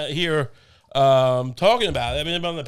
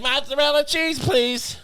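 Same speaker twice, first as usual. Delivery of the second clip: put-on much higher and louder, a childish sing-song "Mozzarella cheese, please"; imitating a five-year-old girl asking sweetly; mock little girl; 11 semitones higher, 3 dB louder, slower than usual. Mocking a five-year-old girl asking for cheese, much higher. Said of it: "I can't be like".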